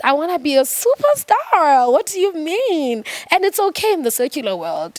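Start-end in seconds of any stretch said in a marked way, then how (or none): 0.0-3.0 higher, emphatic wannabe-star declaration; higher-pitched mock indignation; starry-eyed insistence. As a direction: act out an incredulous would-be superstar. Acts out her old ambition.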